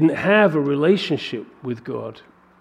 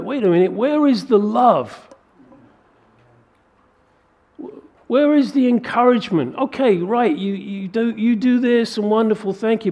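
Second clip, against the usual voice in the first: raised, pleading where-is-the-love voice; exasperated complaint; 7 semitones higher, 3 dB louder than usual. Retold or imitated. Believer pleading with fellow Christians, exasperated and high. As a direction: plead loudly, fed up and hurt.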